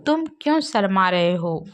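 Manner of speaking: ordinary delivery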